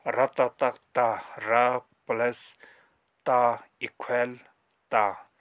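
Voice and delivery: र जतक जा, slowly, syllable by syllable; slow demo reading. Shown as a demonstration. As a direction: sound it out for the class. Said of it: "इस तरह से पढ़ते हैं". (धीमे-धीमे अक्षर जोड़कर पढ़ना)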